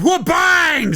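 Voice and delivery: high yelled wha-bang; loud dunk shout. Retold or imitated. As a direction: blast the dunk noise. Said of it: "says Matty T".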